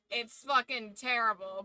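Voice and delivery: ordinary talk, nothing marked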